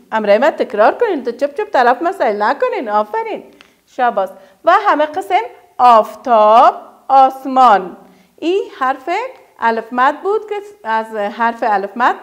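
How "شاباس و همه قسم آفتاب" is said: ordinary talk, nothing marked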